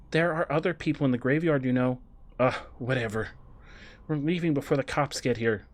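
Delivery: ordinary talk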